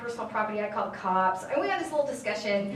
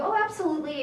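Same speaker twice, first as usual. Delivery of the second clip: high, mock-eager agreement; breezy compliance; 5 semitones higher, faster than usual. Humoring the rules staffer about the sign, breezily compliant. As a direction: agree too readily, voice lifted.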